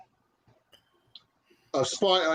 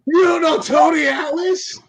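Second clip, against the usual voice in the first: incredulous; 9 semitones higher, 6 dB louder than usual. Real, not acted. Incredulous shout at friend.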